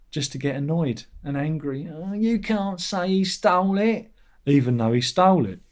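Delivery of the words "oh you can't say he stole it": high, slow whiny mock voice; mocking commenter. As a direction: sneer like a petty commenter.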